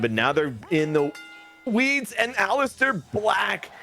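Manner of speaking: high, drawn-out wrestling hype call; dramatic announcer build-up. Acted. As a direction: draw out the big finish, pitched high. Dramatic wrestling show narration.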